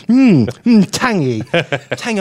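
a hint of savouring judge; mock tasting hum, lightly done; Mary Berry tasting. Host imitating the judge tasting, lightly. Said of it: "Mary Berry having a".

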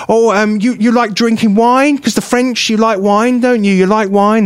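imitated patronising questioner, pitched high; high-pitched mock condescension. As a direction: quiz the foreigner in a high, condescending voice.

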